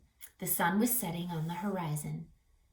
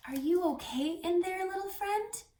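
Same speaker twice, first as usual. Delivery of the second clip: tender concern; 9 semitones higher than usual. Acted. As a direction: coax a scared creature gently. Animal checks on box creature.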